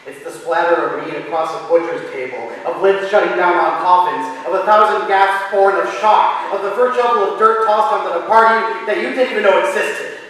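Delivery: narration climbing from steady to loud and high; building intense narration. A narrator's reading. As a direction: let the telling swell into a frenzy. Narration builds to frenzied climax.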